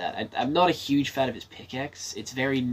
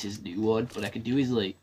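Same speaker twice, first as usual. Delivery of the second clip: usual speaking voice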